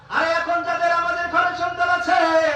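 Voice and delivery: high shouted sermon call; fiery preaching. Acted. Preacher calling out to parents in the crowd, fiery shout. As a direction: rouse the congregation.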